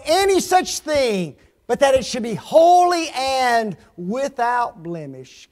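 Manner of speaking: loud, high scripture proclamation; preach. Real, not acted. Preacher quoting Paul about the church, full-force preaching. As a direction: ring out each phrase of the verse.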